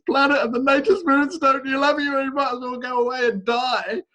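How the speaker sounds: high, taunting voice of doubt; mocking inner voice. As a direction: sneer it like the voice that tells you you're unwanted. Voicing a discouraging inner voice.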